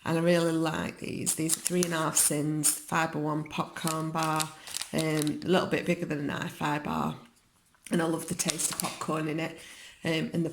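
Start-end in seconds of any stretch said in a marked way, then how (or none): none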